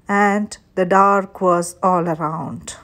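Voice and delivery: usual speaking voice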